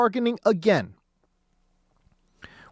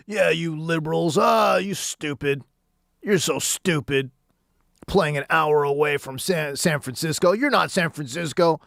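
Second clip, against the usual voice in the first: lower, sneering mock of an East Coast fan; 5 semitones lower than usual. East Coast fans taunting San Francisco, in a low mocking voice. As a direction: taunt like a smug rival fan. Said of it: "being like".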